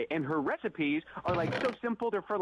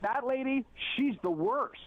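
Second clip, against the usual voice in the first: mildly raised, higher mock shout; mock loud voice; 8 semitones higher, slower than usual. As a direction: imitate her calling it out, not full force. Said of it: "in a really loud voice".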